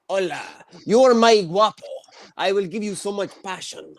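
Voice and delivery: hammed-up Spanish accent; Spanish girlfriend. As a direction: play a passionate Spanish lover, teasingly. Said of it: "my girlfriend's Spanish".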